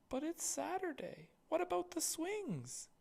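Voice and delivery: put-on a small boy pleading; little brother.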